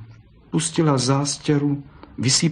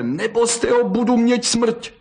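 desperate wail; 12 semitones higher than usual. A narrator's reading. Continues desperate cry.

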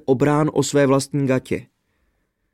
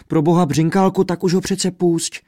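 high, urgent pleading; 6 semitones higher, 3 dB louder than usual. A narrator's reading. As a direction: beg him to let the king go. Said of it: "prosila Světluška". (prosebně, vysoko)

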